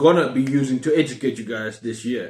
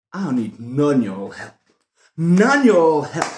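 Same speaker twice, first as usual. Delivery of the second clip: put-on southern accent; slower than usual. Refusing y'all's help in a slow southern accent. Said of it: "It's my southern accent".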